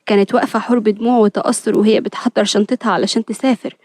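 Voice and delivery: ordinary delivery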